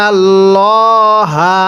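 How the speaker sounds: ইন্নাল্লাহা with a thick, heavy lam and no stretch on nun; heavy Allah. Shown as a demonstration. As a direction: make the lam of আল্লাহ full and heavy. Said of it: "মোটা করে পড়ব".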